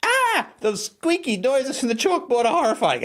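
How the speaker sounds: put-on high, whiny child's wail; horrified child. Host playing a child hearing the chalkboard, high wail. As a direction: squeal it like a kid hearing chalk squeak. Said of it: "the mind of a child".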